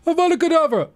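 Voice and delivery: high, loud spell cast; shouted spell. Acted. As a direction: yell the curse like casting it. Casting a spell in game.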